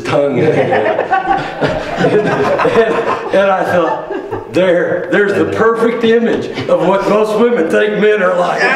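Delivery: laughing through the punchline. Real, not acted. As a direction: chuckle through the words. Laughing at his own joke.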